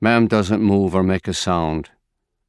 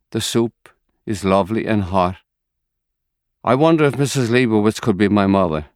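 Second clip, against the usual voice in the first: ordinary delivery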